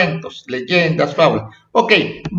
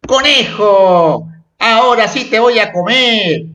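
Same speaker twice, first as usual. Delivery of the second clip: exclamación amenazante; 5 semitones higher, 4 dB louder than usual. Acted. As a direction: read it out as a storybook threat. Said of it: "Una afirmación es lo que algo, alguien dice". (exclamación amenazante de cuento, alargada)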